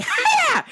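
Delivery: high sharp martial arts cry; karate yell. Retold or imitated. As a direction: bark it like a karate chop. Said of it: "And I was like".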